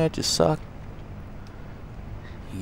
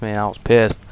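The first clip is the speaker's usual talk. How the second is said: ordinary talk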